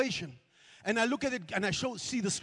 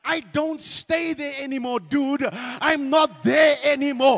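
loud defiant shout; shouting at the devil; 10 dB louder than usual. Acted. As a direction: yell back at him. Telling off the devil.

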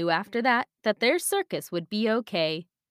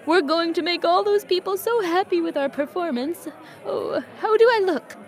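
lightly voiced eager horse; 7 semitones higher than usual. Priscilla voiced in story.

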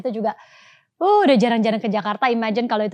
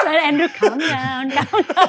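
slow, laughing calm down; laughing teasing; 4 semitones higher, much slower than usual. Real, not acted. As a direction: tease him to calm down. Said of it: "teasing, laughing".

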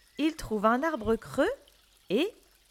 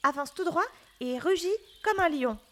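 lightly voiced rabbit directions; rabbit guiding; 3 semitones lower, faster than usual. A narrator's reading. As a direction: guide him along in a hurry. Rabbit voice in story.

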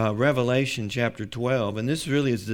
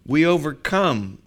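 forceful proclamation; 4 semitones higher, 7 dB louder than usual. Acted. Preacher proclaims scripture at force.

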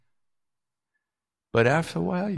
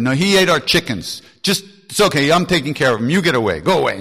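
shouting; 6 semitones higher, 11 dB louder than usual. Retold or imitated. Dada shouting at the people.

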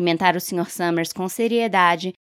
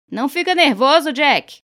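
chamando alto e bem agudo; incentivo gritado; 8 semitones higher, 6 dB louder than usual. A narrator's reading. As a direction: anime o rapaz na multidão. Alguém encoraja o rapaz. (alguém da plateia grita para Jack, agudo e alto)

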